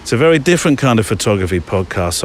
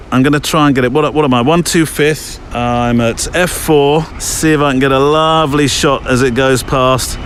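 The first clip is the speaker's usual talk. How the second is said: ordinary delivery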